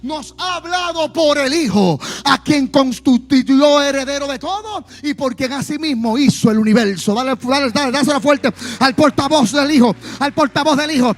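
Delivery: preaching at full force. Acted. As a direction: pour it out loudly to the church. Shouted preaching and prayer.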